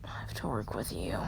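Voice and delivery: low, quiet grumble; low-voiced, hurried reluctance; grudging. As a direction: mutter it with weary reluctance. Grudging partner quote, sighs after.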